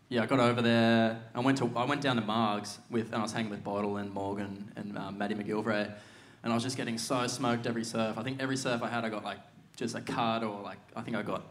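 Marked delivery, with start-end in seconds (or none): none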